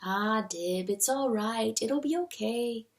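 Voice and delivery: soft, hushed comfort; tender, quiet reassurance; soothing mother. A narrator's reading. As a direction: speak softly to calm an upset child. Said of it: "The woman smiles and holds him close".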